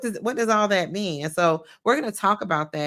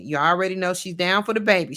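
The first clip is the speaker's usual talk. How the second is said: ordinary talk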